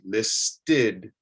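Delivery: "listed" split as LIS-TID; "listed" with a clear "id" after the T; id ending. Shown as a demonstration. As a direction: say "listed" low, in two careful beats. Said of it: "a T before the E D".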